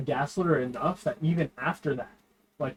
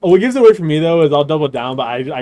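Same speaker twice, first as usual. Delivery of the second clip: loud excited; 15 dB louder, faster than usual. Real, not acted. Excited storytelling, much louder.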